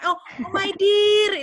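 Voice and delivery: hammed-up call with "dear" split into di-a; wrong pronunciation. Shown as a demonstration. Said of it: "Jadi kalau bilang".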